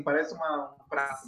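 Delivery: ordinary delivery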